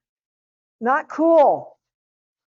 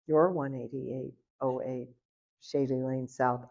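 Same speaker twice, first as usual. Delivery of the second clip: low, quiet dawning recognition; hushed realisation; 7 semitones lower, 6 dB quieter than usual. Retold or imitated. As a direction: read out the address low and softly, as it sinks in. Visitor realises she found the address.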